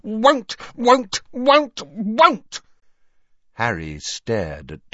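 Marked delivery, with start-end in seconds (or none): 0.0-2.6 very high, insistent elf croak; croaking house elf. A narrator's reading. House elf refusing over others' talk, shrill croaking. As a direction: croak each refusal out sharply. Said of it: "Creature's continued croaks".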